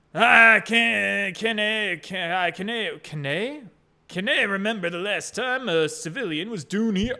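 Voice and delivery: tentative attempt at a Scottish accent; funny Scottish voice. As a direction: feel your way into Scottish, restarting. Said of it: "does he talk a little bit funny?".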